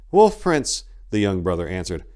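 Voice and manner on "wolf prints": alarmed. Acted. Young brother spots hellhound tracks.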